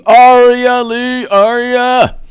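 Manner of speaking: high, loud, slow drawn-out call of a name; thanking a viewer with a high drawn-out call. Acted. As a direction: stretch the name up high like hailing a friend. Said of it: "You rock the impersonations".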